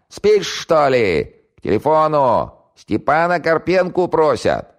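high, loud, slow calling across the flat; yelling at full voice. Acted. Valiulina summoning Styopa to the phone, loud slow yell. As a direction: bellow it so the sleeper wakes. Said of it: "заорала во всё горло".

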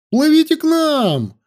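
high-pitched eager call; excited shout. A narrator's reading. Anil calls to girls across the lake, excited shout. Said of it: "закричал воодушевлённый Анил".

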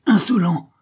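one high, loud angry word; indignant outburst. A narrator's reading. Captain to the man grabbing his wife, indignant. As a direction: burst out at the offender.

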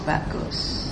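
a soft whisper of bagus; whispered echo. Shown as a demonstration. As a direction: breathe the word bagus. Echoes the word under her breath.

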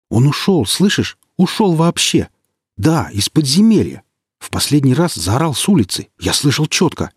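high, loud eager insistence; insist, voice up high; excited insisting comrade. Acted. Comrade insists monster left.

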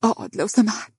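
a voice spent from weeping; hoarse from crying. A narrator's reading. Grieving wife asking husband to stay, hoarse from crying. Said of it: "بصوت مبحوح من كثرة البكاء".

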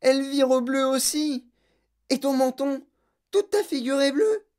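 high, mounting horror; panicked cry. A narrator's reading. Mother watching blue spread over daughter, panicked. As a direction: exclaim each discovery in high-pitched panic. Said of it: "hurla madame Beauregard".

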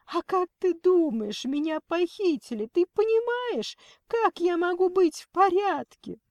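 very high, loud tearful wail; sobbing outburst. A narrator's reading. Kidnapped Lena reproaches her worried mother, sobbing. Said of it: "Лена всхлипнула".

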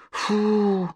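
long exhaled "phew"; drawn-out sigh of relief; relieved puff. A narrator's reading. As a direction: blow out the tension. Sigh of relief after scare.

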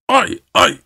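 a light narrated yelp while running; mild mock yell. A narrator's reading. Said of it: "diye bağırıyordu dedem". (hafif, tiz bir çığlık taklidi)

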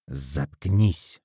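low, cold order to be silent; harsh. A narrator's reading. Man silences his girlfriend, harsh. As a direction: throw it down hard without raising the voice. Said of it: "жёстко бросил Виктор".

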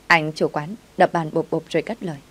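usual speaking voice

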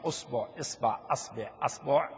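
أصبع with a, إصبع with i, then أصبع, أصبع again; variant pronunciations. Shown as a demonstration. Teacher listing the correct ways to say the word. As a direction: cycle the first vowel of أصبع between a and i. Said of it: "كيفما قلتها صحيحة".

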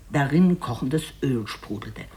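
ordinary talk

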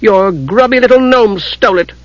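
high, indignant retort; flashing back. A narrator's reading. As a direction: throw the blame straight back. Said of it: "Lunatari flashed back".

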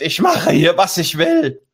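high, loud acted yell; hammed-up defiant screaming; defiant shout. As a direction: shout it out like an outraged cyclist. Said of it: "steigt sie wieder auf und schreit".

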